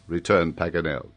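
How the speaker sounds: ordinary delivery, nothing marked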